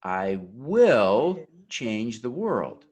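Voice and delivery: slow, with "will" swelled and drawn out; stress on WILL. Shown as a demonstration. Speaker leading an oath with the audience, stress on "will". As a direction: lean long and hard on the word "will". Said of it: "emphasize will".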